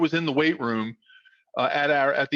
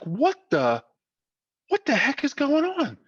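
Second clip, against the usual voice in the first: higher-pitched mock bewilderment; baffled; 13 semitones higher than usual. Players hearing a crowd round the corner, acted bafflement. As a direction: act out a stunned double take. Retold baffled reaction to the roar.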